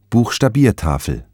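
ordinary delivery

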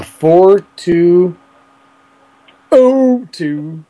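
slow, evenly spaced robotic digits. Acted. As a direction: read the numbers like a robot. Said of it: "I read that in kind of a robot voice".

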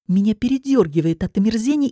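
usual speaking voice

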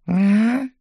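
high-pitched imitated meow; cat's meow. Div shrunk to cat size answers, high meow. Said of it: "сказал он".

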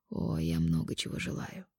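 husky, rasped lover's line; hoarse voice. Acted. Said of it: "Хриплый голос волновал".

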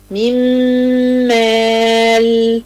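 slow recitation of من مال, the nun merged into a long humming mim; تلاوة بطيئة مع غنة; nasal merging demo. Shown as a demonstration. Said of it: "عايزة مثال مع الميم".

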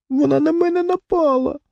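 very high, tearful little voice; sobbing. A narrator's reading. Wife defends herself to angry husband, sobbing. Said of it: "схлипнула дружина".

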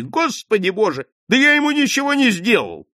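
high, loud indignant protest; exploding, stung. A narrator's reading. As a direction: burst out at the false blame. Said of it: "Джонни взорвался, не стерпев обиды". (обиженно взорваться)

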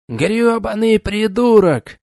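put-on thick, high, slow Irish accent. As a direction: rant in a heavy Irish brogue. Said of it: "объявил он с жутким ирландским акцентом".

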